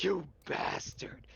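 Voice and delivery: drawn-out, hoarse whispered mock scream. Game master voicing the woman who was just shot, hushed mock scream. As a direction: stretch it out as a voiceless pretend scream.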